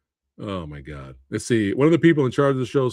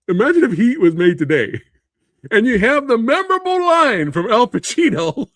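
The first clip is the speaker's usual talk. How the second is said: laughing; 8 semitones higher, 3 dB louder than usual. Acted. Mocks imagining the scene, laughing.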